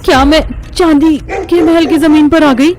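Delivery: high, stammering frightened voice; frightened realisation. Acted. Girl realises she is in the silver palace, stammering in fear. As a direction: stutter in dawning terror.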